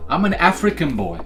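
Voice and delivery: ordinary talk, nothing marked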